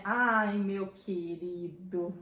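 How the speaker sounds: exaggerated, patronizing fake affection; ironic endearment. Shown as a demonstration. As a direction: drag the term of endearment out mockingly. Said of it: "a entonação vai dizer pra gente que é uma ironia".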